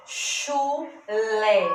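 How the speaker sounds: CHU, pause, LÉ, each syllable held; split into syllables. Shown as a demonstration. Teacher showing two syllables in chulé, syllable by syllable. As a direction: sound out chulé in two separate beats. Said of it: "Eu abro a boca duas vezes pra pronunciar".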